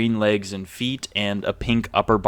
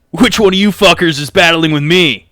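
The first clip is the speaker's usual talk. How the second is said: loud, higher challenge; brash Craig; 6 semitones higher, 10 dB louder than usual. Acted. Craig sizing up the challengers, brash and loud. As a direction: call them out with swagger. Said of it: "Craig looks at you all, he goes".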